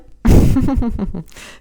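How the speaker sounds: a short real laugh at her own jab; amused laugh. Real, not acted. Presenter teasing her viewers, amused laugh.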